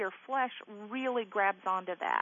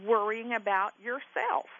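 ordinary talk, nothing marked

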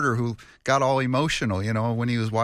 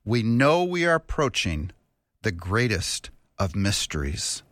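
usual speaking voice

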